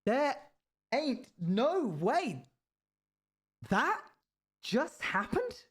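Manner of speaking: "There ain't no way that just happened", high-pitched and broken up by pauses; slow, high, hammed-up stress on each word of "no way" and "just happened"; each word emphasised. Shown as a demonstration. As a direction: overact "Are... you kidding me" in a high, halting voice. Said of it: "put emphasis on each word individually".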